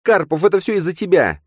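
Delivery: high, loud blaming scream. Acted. Nastya screams at Karpov, blaming him. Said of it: "закричала Настя".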